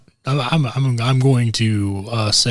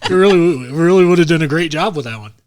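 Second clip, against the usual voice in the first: excited; 6 semitones higher, 6 dB louder than usual. Real, not acted. Excited laughing enthusiasm.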